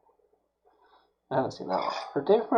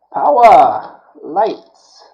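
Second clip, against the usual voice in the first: loud, raised-pitch call-out; mock grand command; 5 semitones higher, 12 dB louder, much slower than usual. Host testing freshly wired lights, mock grand command. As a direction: boom it like a grand switch-on.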